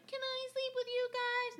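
put-on high little-kid voice; pleading child. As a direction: ask like a small kid at bedtime. Voicing herself as a child.

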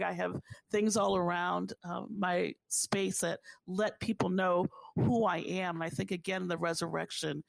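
ordinary talk

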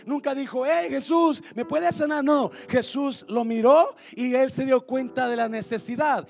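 loud, high-pitched, driving sermon; full-force preaching. Real, not acted.

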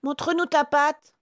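put-on high, loud little-kid voice; demanding little goats. A narrator's reading. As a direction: insist on proof as little goats. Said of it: "demandent pour être sûrs". (chevreaux qui exigent, voix aiguë)

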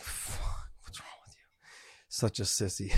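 muttered whisper to himself; sick man scolding himself in a whisper; whispered self-scolding. Retold or imitated. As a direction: hiss it under your breath. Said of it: "I'm like".

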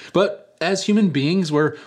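usual speaking voice

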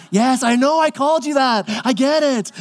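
mock guilty admission; sheepish husband. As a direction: caught out and owning it. Zechariah sheepishly answers wife.

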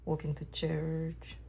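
normal talking voice

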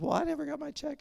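put-on a sweet old Southern grandma, confused at the table; old Southern lady. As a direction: play a puzzled old Southern lady who missed her bill. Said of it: "she's an old Southern lady".

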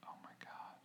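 breathless whisper of shock; whispered oh my God. Retold or imitated. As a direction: whisper it in disbelief. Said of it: "and I was just like".

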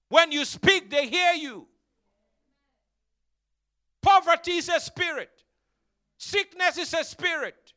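high, loud pulpit proclamation; full-force preaching. Real, not acted. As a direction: thunder it out in short bursts with pauses.

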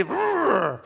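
high-pitched straining roar; imitated effort roar. Preacher imitating Scottish rock throwers, effort roar. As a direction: growl with the effort of heaving.

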